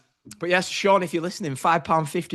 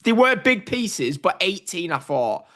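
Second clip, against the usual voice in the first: usual speaking voice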